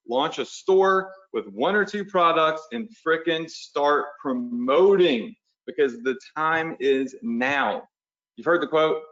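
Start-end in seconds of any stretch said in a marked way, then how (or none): 0.1-7.9 each word laid down slowly, pushing them to act; slow insistent urging. Shown as a demonstration. Coach urging webinar viewers to launch now, slow and insistent. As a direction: drag it out to make them move. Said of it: "slow urging".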